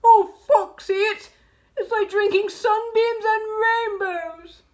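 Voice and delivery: high, loud, swooning praise; gasped rapture. A narrator's reading. Badger telling Foxy of the cider, high rapture. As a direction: gush it in a singing lilt. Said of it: "he gasped".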